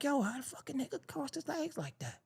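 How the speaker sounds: high, soft baffled aside; hushed disbelief. Retold or imitated. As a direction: whisper it in total disbelief. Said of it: "I'm like".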